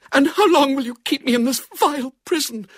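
high, loud melodramatic indignation; trembling with anger. A narrator's reading. Girl held prisoner to her captor, trembling with anger. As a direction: rage at your captor in a high voice. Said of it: "in a voice that trembled with anger".

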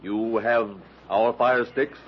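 ordinary talk

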